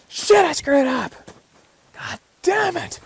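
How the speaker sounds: imitating a furious slow yell, way up high; snapping outburst. Hunter snapping at himself in camp, mock furious yell. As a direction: curse at yourself like you've lost it. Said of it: "And he's snapping".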